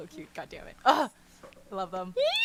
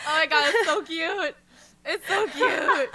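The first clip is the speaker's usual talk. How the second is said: higher, louder squealing delight; fangirling; 6 semitones higher, 4 dB louder than usual. Real, not acted. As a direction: gush over the cute scene. Fangirling over cute scene.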